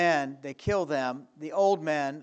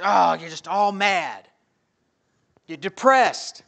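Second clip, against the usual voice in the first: loud, high exasperated cry; half-playful loud exasperation; loud mock frustration; 3 semitones higher, 12 dB louder, slower than usual. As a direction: act out being fed up and upset. Voicing being mad and depressed.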